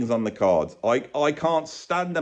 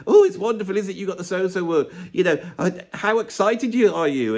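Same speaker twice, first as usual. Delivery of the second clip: bubbly fan; 7 semitones higher than usual. Retold or imitated. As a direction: gush at him like an excited, bubbly fan. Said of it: "she says to [name]".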